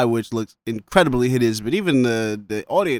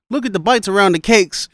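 higher, louder mock-amazed quote; retold exclamation; 7 semitones higher, 5 dB louder than usual. Retelling Luke's line about the cakes, retold exclamation.

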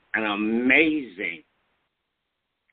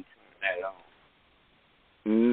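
on speakerphone; much slower than usual. Real, not acted. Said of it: "You sound like you're on a speakerphone".

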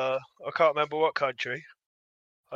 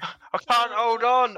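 higher, drawn-out pretend plea; mock desperation; 6 semitones higher, much slower than usual. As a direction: play at losing your grip, stretching the words. Play-acting being pulled into the abyss.

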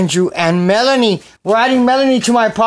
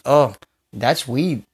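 ordinary delivery

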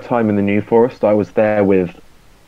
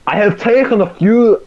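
bad Scottish accent, pitched well higher; 10 semitones higher, 4 dB louder than usual. Trying a new accent for the guessing game, failed Scottish accent. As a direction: attempt a Scottish accent that comes out German. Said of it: "that was Scottish".

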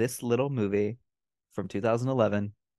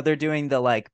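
ordinary talk

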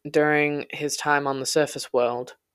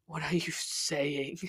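much softer, slow, ragged question; terrified ragged whisper; 9 dB quieter, slower than usual. A narrator's reading. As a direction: barely get the words out. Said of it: "My voice was ragged".

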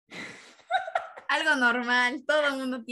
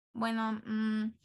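slow, soft resigned sigh; trailing off in defeat; deflated quiet; 2 semitones lower, 7 dB quieter than usual. Real, not acted. Defeated by friend's number.

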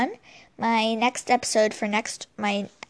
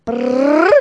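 rolled lips trill, rising; vocal drum roll before the reveal; 7 dB louder than usual. Pretend. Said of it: "it's my drum roll".

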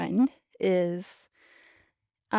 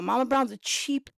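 ordinary talk, nothing marked

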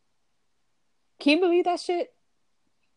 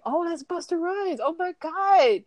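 starstruck; 8 semitones higher than usual. Retold or imitated. Starstruck seeing famous rappers.

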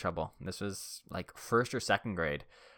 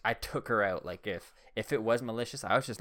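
ordinary delivery

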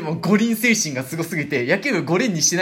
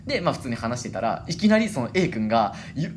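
ordinary talk

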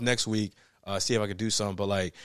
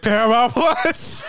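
higher, loud half-crying plea; laughing wail; 11 semitones higher, 7 dB louder, slower than usual. Real, not acted. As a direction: wail it in mock despair. Laughing plea about streaming.

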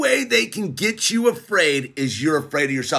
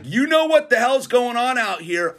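ordinary talk, nothing marked

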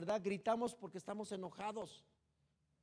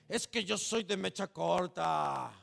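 proud boast; 3 semitones lower, 4 dB louder than usual. Retold or imitated. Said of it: "hasta con orgullo decimos".

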